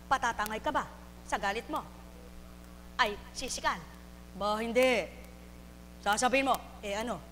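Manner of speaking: ordinary delivery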